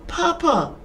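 put-on high child's call, only mildly faint; high-pitched little voice answering. A narrator's reading. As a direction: call out as a small boy heard from afar. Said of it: "that little faint voice come again".

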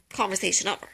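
ordinary talk, nothing marked

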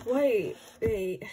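usual speaking voice

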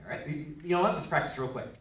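normal talking voice

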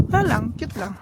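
usual speaking voice

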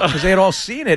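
ordinary talk, nothing marked

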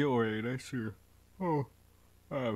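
real yawn while talking. Real, not acted. Player apologizing because it's early, yawning. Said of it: "I'm yawning".

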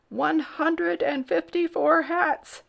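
slow, high counting voice; aghast courtier. A narrator's reading. Sir Alaric tallying the hats, aghast. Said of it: "gulped Sir Alaric".

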